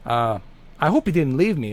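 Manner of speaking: ordinary talk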